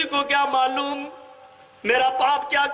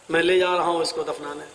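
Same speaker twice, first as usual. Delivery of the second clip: lower, fast hard announcement; cold father; 11 semitones lower, faster than usual. Acted. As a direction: state it coldly and fast. Angry father decides to bury. (غصے میں باپ کا سرد اعلان، تیز اور نیچی آواز)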